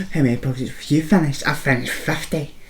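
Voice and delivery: bragging mock voice. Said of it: "being like".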